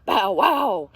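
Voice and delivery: loud bow wow; dog bark. Acted. Reading to kids, voicing the big red dog, loud barking. Dog sound in picture book.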